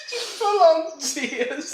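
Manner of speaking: high, slow giggle through the words; can barely get it out for laughing. Real, not acted. Husband teasing wife about her tears, laughing high.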